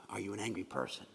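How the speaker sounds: ordinary talk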